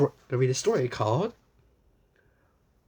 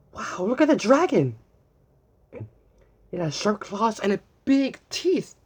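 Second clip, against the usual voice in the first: excited; 4 semitones higher, 4 dB louder than usual. Acted. Excited reading to children.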